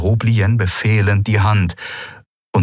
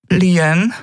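intimidated; 12 semitones higher than usual. Acted. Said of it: "begann ihre Mutter eingeschüchtert".

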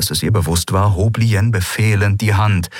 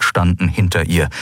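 ordinary delivery

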